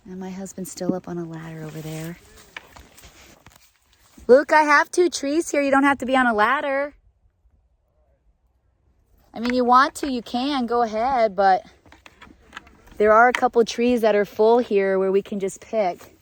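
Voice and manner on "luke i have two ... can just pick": loud, high call across the orchard. Real, not acted. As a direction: shout over to him. Calling to husband on ladder.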